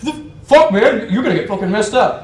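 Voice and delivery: loud, high, heated mock yell; imitated angry threat. British guy threatening his rival, shouting angrily. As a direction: threaten him as the riled-up tough guy.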